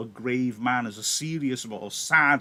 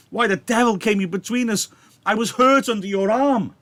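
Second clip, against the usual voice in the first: loud, higher angry curse; cursing; 4 semitones higher, 6 dB louder than usual. Acted. Mercutio cursing Romeo for stepping between, loud.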